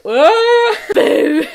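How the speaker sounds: high loud yelp then a loud playful boo; mock scare. Reader playing the story's bad guy to scare viewers, mock yelp and boo. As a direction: jump out like a pretend monster.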